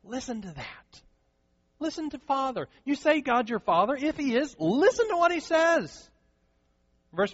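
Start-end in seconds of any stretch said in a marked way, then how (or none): 0.0-6.1 urgent plea building. Retold or imitated. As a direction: press them harder and harder to listen. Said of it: "Jesus says".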